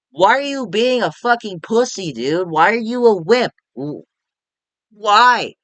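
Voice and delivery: high, exasperated questioning; angered. Real, not acted. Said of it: "it just angers me".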